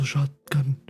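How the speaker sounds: ordinary talk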